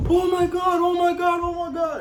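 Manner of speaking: high, loud, panicked repetition; panicked oh my God. Retold or imitated. Sister bursting in on the birth, imitated high panic. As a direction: imitate her frantic panic. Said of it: "And China was like".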